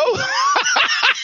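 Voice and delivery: high, loud burst of laughter. Real, not acted. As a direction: laugh out at the word. Laughing at slang word.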